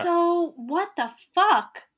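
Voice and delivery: slow, high drawn-out disbelief; indignant. Acted. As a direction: act out an outraged reaction, stretched out. Listener acts out indignant reaction.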